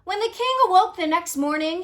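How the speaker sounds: plain reading voice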